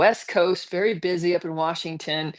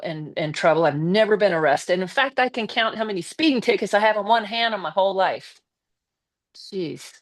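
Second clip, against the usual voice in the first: ordinary talk, nothing marked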